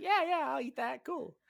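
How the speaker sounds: high, quick eager yes; mock-eager high-voiced yes; eager acceptance. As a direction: gobble up the offer, voice pitched high. Shoto happily accepting food.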